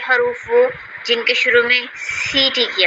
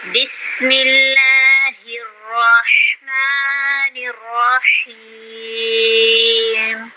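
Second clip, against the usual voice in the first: slow, drawn-out recitation; Quranic recitation; much slower than usual. Acted. Teacher opening the lesson with recitation, slow and drawn out. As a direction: intone it slowly like tilawat.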